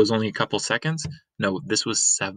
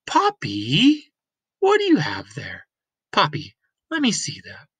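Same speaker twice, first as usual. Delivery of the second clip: put-on much higher girl's voice; bright young girl calling out; girl Lena; 6 semitones higher, 3 dB louder than usual. Lena coming home to her Granny, higher girl's voice.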